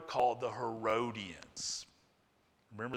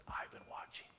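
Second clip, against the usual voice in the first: hushed, breathy-free whisper of a warning; 15 dB quieter than usual. Acted. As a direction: lean in and whisper it like a secret threat. Preacher whispers God's warning.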